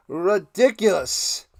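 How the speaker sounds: ordinary delivery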